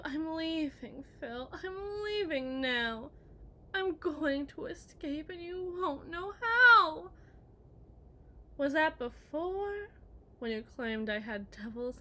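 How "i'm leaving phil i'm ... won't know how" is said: slow, louder whining mimicry of him; mocking husband, whiny. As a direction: mimic him as a sniveling coward. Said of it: "Whatever happened to".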